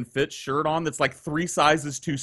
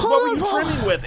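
incredulous question. Retold or imitated. Narrator retells his shocked question.

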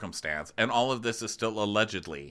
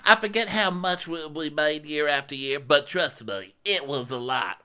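mock Erica; 6 semitones higher than usual. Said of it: "she goes".

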